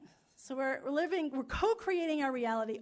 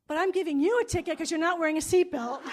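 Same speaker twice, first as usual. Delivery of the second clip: louder, higher officer punchline; gleeful officer's gotcha; punchline delivered big; 6 semitones higher, 11 dB louder than usual. Retold or imitated. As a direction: land the twist with a grin.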